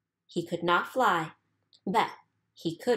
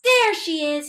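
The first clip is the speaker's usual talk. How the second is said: higher and louder, eager cry; excited chick; 8 semitones higher, 8 dB louder than usual. A narrator's reading. Baby bird spots the boat, high and excited. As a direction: spot her and light up. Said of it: "he said".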